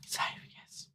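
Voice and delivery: gasped, unvoiced whisper of save. Real, not acted. Musician hushed as the project saves, whispering. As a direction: breathe the word save out in a whisper.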